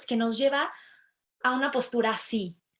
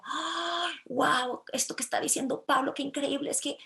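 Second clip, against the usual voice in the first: higher, hammed-up wide-eyed gushing; asombro imitado; 5 semitones higher than usual. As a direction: gasp and gush in mock amazement. Imita a una casada que se maravilla. (asombro exagerado, en tono agudo)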